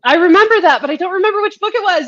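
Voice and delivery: bubbling over with delight; excited. Real, not acted. Excited at a friend's cameo.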